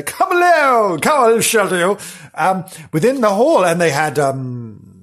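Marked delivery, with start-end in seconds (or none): 0.0-2.0 welcoming family. Retold or imitated.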